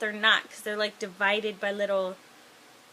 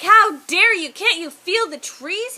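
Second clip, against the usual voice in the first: high, loud acted outrage; hammed-up indignant outburst; losing it; 7 semitones higher, 10 dB louder than usual. Krista catches him carving the tree, acted outrage. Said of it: "Krista loses it".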